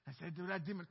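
ordinary delivery

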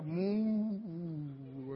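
long, drawn-out eerie "moooo", trailing down; mock spiritual moo. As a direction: draw out a spooky mock-mystical "mooo". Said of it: "flaky spiritual".

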